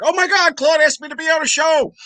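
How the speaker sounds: high-pitched, gushing retold excitement; mock excitement. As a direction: squeal it like a starstruck fan. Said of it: "I was like".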